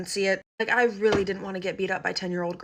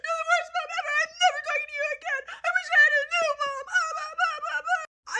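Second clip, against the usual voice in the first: very high, wailing tantrum; imitating a hysterically crying kid; mock sobbing. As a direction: bawl it out, squeaky and exaggerated. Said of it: "and she is sobbing".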